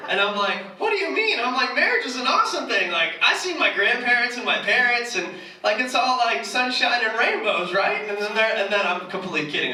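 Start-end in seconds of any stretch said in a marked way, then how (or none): 0.8-8.1 high, overdone starry-eyed gushing; sarcastic gushing. Acted. Sarcastic high gushing, answering the people who call marriage a bad choice. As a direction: lay the sarcasm on thick, sweet and high. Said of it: "That's sarcasm, guys".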